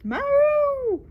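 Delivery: high loud yowl; cat yowl. Acted. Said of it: "who hissed".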